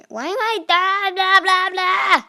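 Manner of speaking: high loud nonsense babble; mock babble. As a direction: gibber it out in silly frustration. Mocking frustrated babble.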